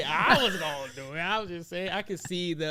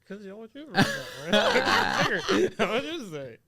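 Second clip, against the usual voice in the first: laughing; 11 semitones higher, much slower than usual. Real, not acted. Laughing while explaining whisper.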